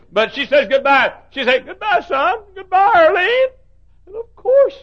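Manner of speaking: high, loud preaching with a laugh; full-force preaching. Acted. Preacher joking about his mother's parting, lively preaching. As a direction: preach it with warmth and humour.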